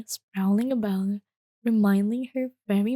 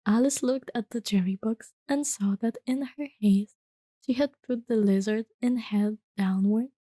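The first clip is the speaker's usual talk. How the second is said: ordinary delivery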